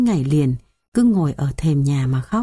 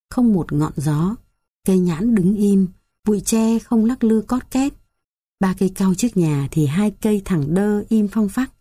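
ordinary delivery, nothing marked

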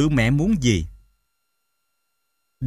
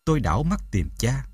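ordinary delivery

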